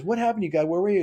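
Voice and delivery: high, pleading cry to God; man asking God. Retold or imitated. Drowned man complaining to God, high and pleading. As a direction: raise the pitch, plead upward. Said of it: "He goes".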